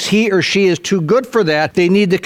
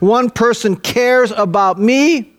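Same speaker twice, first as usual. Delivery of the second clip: impassioned high plea; 8 semitones higher, slower than usual. Real, not acted. Preacher's impassioned peak.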